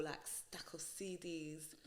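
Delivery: whispered aside. Real, not acted. Whispering about her mum's CDs. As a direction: drop to a whisper.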